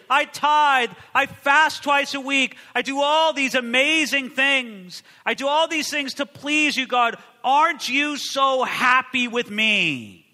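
hammed-up self-righteous boast, slowed down; boasting Pharisee. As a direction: list your good deeds to impress God. Preacher voicing the boasting Pharisee.

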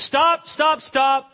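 loud, high repeated command; calling a halt. Acted. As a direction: halt a whole procession with your voice. Jesus halts the moving procession.

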